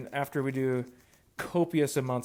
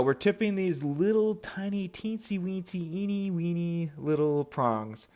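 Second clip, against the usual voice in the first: high, drawn-out playful voice; playful tiny talk; 5 semitones higher, slower than usual. Pretend. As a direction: stretch it out high, as if describing something minuscule. Playing up how tiny prongs are.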